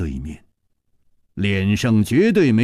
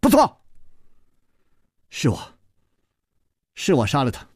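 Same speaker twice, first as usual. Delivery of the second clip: stern fierce voice; 6 semitones higher than usual. Acted. Gong Nanyan fiercely confessing and threatening Chu Liuxiang. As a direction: snap it out as a threat. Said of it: "宫南雁忽然厉声说".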